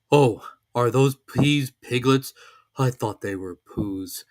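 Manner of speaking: a cosy storybook bear, proud; Pooh voice. A narrator's reading.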